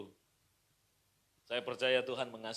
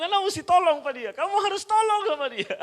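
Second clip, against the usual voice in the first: high, loud mock mother begging with a laugh; mother pleading; 14 dB louder than usual. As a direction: play the frantic mum, half laughing. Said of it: "Mama saya nangis".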